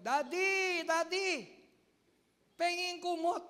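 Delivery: put-on teen yelling to dad for a blanket; calling out to dad. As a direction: call for dad from bed. Teen calling father at night.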